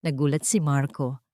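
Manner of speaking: plain reading voice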